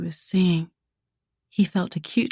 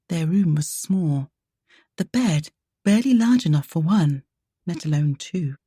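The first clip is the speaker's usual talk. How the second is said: usual speaking voice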